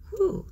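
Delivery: sharp high whew of relief; relieved whew. Real, not acted. Vlogger bracing for a tricky place name, high whew. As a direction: blow out a high whew before a hard word.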